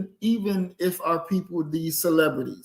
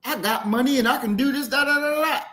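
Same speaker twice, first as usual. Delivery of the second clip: higher-pitched mocking brag, trailing into nonsense syllables; mock boasting; 7 semitones higher, faster than usual. Mocking a star showing off his money to a salesman, mock boasting. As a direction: imitate a show-off rattling on.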